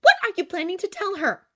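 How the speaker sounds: high, loud confrontation; standing over him, accusing; angry demand. A narrator's reading. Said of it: "Glinda went on, standing over him".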